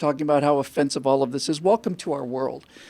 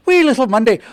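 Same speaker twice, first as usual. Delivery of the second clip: put-on a dash of Irish accent, louder and higher; quick Irish accent; 4 semitones higher, 8 dB louder than usual. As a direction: toss off "wee little Monday" in a playful Irish lilt. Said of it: "as soon as you went into the Irish accent".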